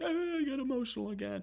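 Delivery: high, fast, mocking whiny voice on "Oh, I got emotional again."; mock my own sappy self, way up high; mocking myself. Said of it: "That was literally not me".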